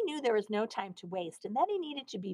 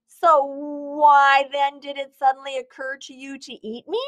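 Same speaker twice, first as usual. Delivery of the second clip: demanding; 5 semitones higher, 8 dB louder than usual. A narrator's reading. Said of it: "Arnie demanded".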